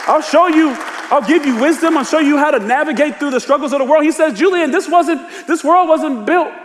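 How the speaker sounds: high, rising preacher's fervor; full-force preaching. Acted. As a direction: proclaim it to the congregation, pitched high.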